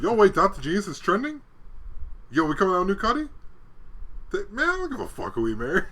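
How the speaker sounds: hyped up and eager; excited. Retold or imitated. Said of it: "I was like".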